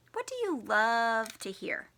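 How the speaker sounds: ordinary talk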